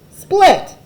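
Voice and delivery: high, loud, one-word command; sharp order. Acted. Potato plant ordering Egbert to leave, loud and high. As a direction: bark it at him like an order. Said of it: "the plant ordered".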